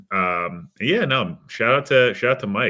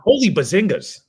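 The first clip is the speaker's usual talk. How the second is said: excited; 12 semitones higher, 8 dB louder than usual. Pretend. Joking exclamation after hype question.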